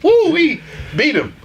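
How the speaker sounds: high, whooping cheer of victory; gleeful triumphant woo; excited cheer. Real, not acted. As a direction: whoop it up like you just won. Cheering a win over someone.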